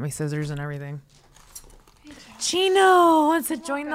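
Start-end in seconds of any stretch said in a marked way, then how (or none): 2.4-4.0 high sweet pet voice. Real, not acted. Talking about her dog.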